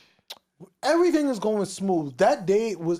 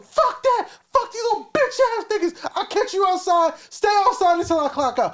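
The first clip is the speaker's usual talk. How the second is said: high, loud, raging yell at the customers; retold furious yelling; 9 semitones higher, 3 dB louder than usual. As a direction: shout him down, fully losing it. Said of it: "He's like, like".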